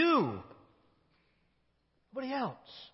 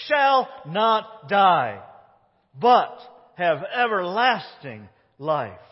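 shall not die drawn out slowly and louder, shall hit hard; emphatic shall; 7 dB louder, slower than usual. Acted. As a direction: deliver God's promise word by word. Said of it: "this word "shall" is significant".

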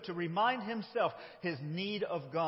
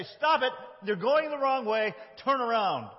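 urgent warning; 5 semitones higher, 4 dB louder than usual. Pretend. Voicing warning prophets.

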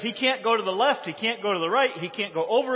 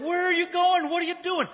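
high-pitched, loud mock scolding; exasperated rider; 13 semitones higher, 5 dB louder than usual. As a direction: holler at a wayward donkey in playful outrage. Acting Balaam scolding his straying donkey.

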